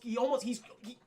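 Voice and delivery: ordinary talk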